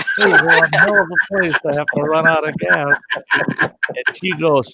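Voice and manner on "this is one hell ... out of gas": higher-pitched exasperated reply; incredulous, raised-voice comeback; exasperated retort. Retold or imitated. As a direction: say it high and worked up. Amused retort while others laugh.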